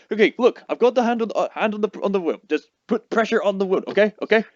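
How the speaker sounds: normal talking voice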